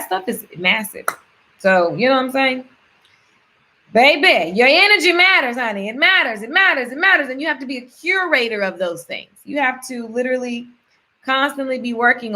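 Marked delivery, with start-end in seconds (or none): 3.9-8.6 emphatic, sing-song insistence; sassy encouragement. Real, not acted. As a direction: hype them up, honey. Sassy pep talk to viewers.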